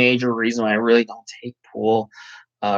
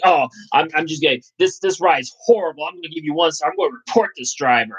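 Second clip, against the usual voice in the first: higher, huffy mock customer threatening one star; mock angry passenger; 5 semitones higher, faster than usual. Driver mimicking a passenger threatening to report him. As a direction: ham up a petty passenger's outrage.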